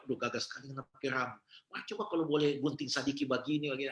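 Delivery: soft, quick, friendly teasing praise; gentle coaxing. Retold or imitated. Aunt gently praises the long-haired youth, soft coaxing. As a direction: coax him kindly to trim his hair.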